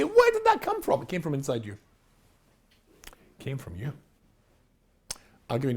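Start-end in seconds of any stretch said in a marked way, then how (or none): 0.0-1.0 high, loud, appalled question; mock horror at your own thought; acted shock. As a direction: ask it aghast, pitched way up.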